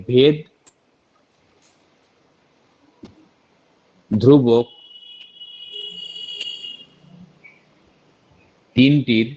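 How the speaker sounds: slow, word by word with long pauses; slow dictation. Shown as a demonstration. Teacher dictating a formula for students to copy, slowly. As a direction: dictate each word so students can write. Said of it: "নিচে লিখে দিতে পারো".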